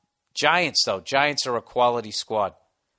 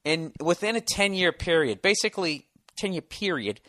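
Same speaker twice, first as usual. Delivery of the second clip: ordinary talk, nothing marked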